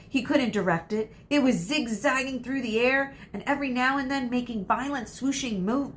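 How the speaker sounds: plain reading voice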